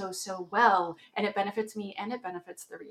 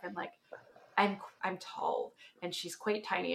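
ordinary talk

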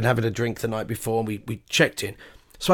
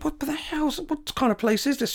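outraged complaint; 13 semitones higher, 5 dB quieter than usual. Retold or imitated. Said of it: "we said".

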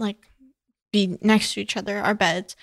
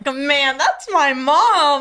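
put-on future kids impressed; 5 semitones higher, 6 dB louder, much slower than usual. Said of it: "They're gonna be like".

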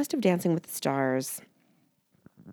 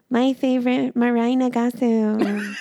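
Wendy voice; 7 semitones higher than usual. Pretend. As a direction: squeal the name in a silly voice. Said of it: "Wendy voices right now".

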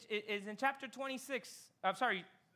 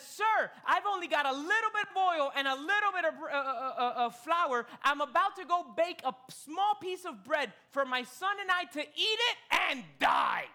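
widow's plea; 7 semitones higher, 5 dB louder than usual. Acted. Widow voiced pleading to prophet.

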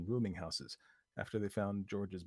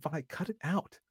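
scolding; 9 semitones higher, 3 dB louder, slower than usual. Acted. Lucy scolds.

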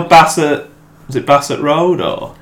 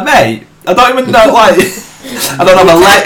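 laughing protest; 8 semitones higher, 4 dB louder than usual. Retold or imitated. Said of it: "I'm like".

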